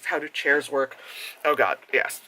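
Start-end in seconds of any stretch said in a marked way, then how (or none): none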